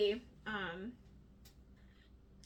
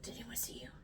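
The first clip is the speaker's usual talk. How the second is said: hushed, furtive mock whisper; secretive whisper; 12 dB quieter, faster than usual. Scotty nervously meeting his visitor in secret, imitated whisper. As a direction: whisper it like a conspirator.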